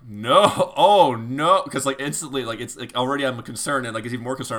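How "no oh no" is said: louder, alarmed "oh no" with a laugh; loud mock panic breaking into laughter; panicked oh no. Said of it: "and I'm like".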